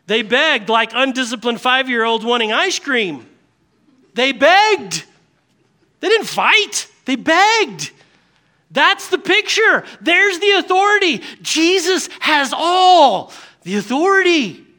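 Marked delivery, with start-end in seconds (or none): 4.1-14.7 high, loud proclamation; forceful preaching. Real, not acted. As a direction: hammer each short phrase home. Preacher driving home the main point.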